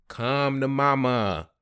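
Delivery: slow, drawn-out sing-song restaurant greeting; come to mama greeting. Shown as a demonstration. Restaurant worker greeting each new customer, slow and hammed-up. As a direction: sell the slogan to a customer, stretched out. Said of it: "and do this shimmy like this".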